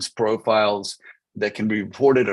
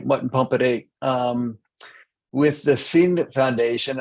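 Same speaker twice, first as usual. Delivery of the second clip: ordinary delivery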